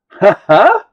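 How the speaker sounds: real burst of laughter, high and loud; loud delighted laugh. Real, not acted. Amused by the camera that follows her face, loud laugh.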